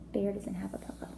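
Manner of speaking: soft unvoiced aside; teacher's hushed aside to the class; whispered aside. Real, not acted.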